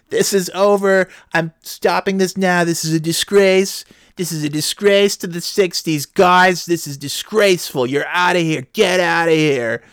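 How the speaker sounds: high, indignant scolding shout; outraged teacher. Retold or imitated. Teacher banishing students from class, outraged and high-pitched. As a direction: throw them out in a high outraged voice.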